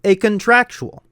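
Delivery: high, loud showman pivot to the sponsor; hammed-up announcer build; hype announcer.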